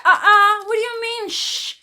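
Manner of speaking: high, loud, outraged uh-uh; woman balking at being hushed, indignant and high; indignant refusal. Retold or imitated.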